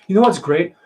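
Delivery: upbeat question. Shown as a demonstration. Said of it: "So it sounds like a sigh".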